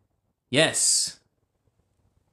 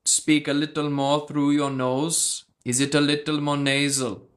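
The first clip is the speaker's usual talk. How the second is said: half-hearted Indian accent impression; bad Indian accent; 2 semitones higher than usual. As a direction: lightly put on an Indian accent through the nose. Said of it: "it's a really bad impression".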